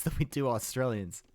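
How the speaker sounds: ordinary talk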